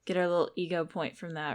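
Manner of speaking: usual speaking voice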